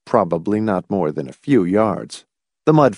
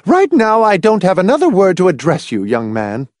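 high, loud start dropping on the end; blazing anger; 10 semitones higher, 4 dB louder than usual. A narrator's reading. Mother refusing to address her son, angry scolding. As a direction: dismiss the child angrily. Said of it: "eyes blazing".

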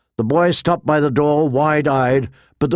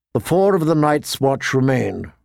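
ordinary delivery, nothing marked

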